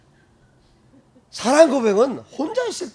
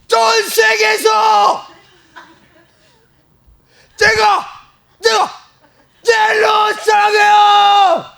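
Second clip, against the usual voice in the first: very high, very loud and slow cry; full shout to God; 14 semitones higher, 12 dB louder, much slower than usual. Shown as a demonstration. Preacher demonstrating to the congregation, shouting her love. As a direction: shout it out with everything. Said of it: "고백하세요, 하나님께".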